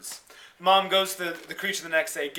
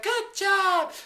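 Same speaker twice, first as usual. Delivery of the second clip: praising mother; 13 semitones higher than usual. Retold or imitated. Woman praising child creature.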